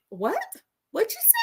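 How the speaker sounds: high, quiet, disbelieving question; startled bystanders. Retold or imitated. Bystanders reacting to his fateful words, mock bafflement. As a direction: mimic a puzzled double-take. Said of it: "Everybody goes".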